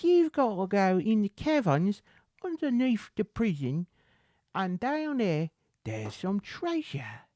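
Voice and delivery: high-pitched Cockney storytelling; Cockney accent. Acted. Beggar quoting the lady about buried treasure, Cockney accent. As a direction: carry on in a put-on high Cockney. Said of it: "I'm getting more Cockney right now".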